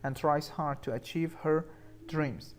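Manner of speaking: slow, careful example reading. Shown as a demonstration. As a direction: read each word out slowly for learners. (خواندن آهسته و شمرده)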